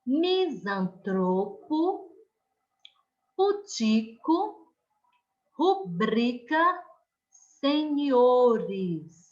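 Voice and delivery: filantropo, circuito, fluido, fortuito read slowly, second-to-last syllable leaned on; slow stressed reading. Shown as a demonstration. Teacher reads the first column of paroxytones to the class, slow and stressed. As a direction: spell out each word slowly, stressing the penultimate syllable. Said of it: "vamos para a terceira coluna".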